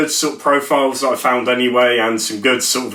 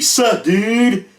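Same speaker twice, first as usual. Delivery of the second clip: a hint of a surfer dude, pitched higher; mock dude greeting; 7 semitones higher than usual. Retelling a stranger's chat reply in a mild dude voice. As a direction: toss off a lightly voiced bro greeting. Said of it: "And he said".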